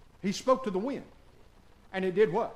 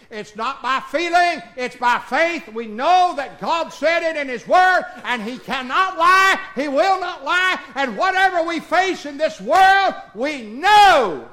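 rising preaching; 7 semitones higher, 7 dB louder than usual. Real, not acted.

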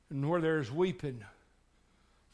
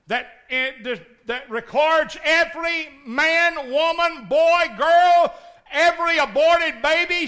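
loud, high, driving pulpit cry; preaching at full force; 12 semitones higher, 13 dB louder, slower than usual. Acted. Preacher to congregation, high loud preaching. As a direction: thunder it at the congregation.

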